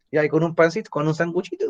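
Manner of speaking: a light sketch of Chileans chatting over a snack; lightly voiced everyday Chileans; typical Chilean chat. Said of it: "Los chilenos hablamos así".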